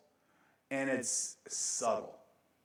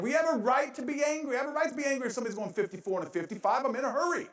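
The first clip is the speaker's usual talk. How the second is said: mock indignation; 10 semitones higher, faster than usual. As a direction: play up your own road rage for laughs. Mock complaint about slow drivers.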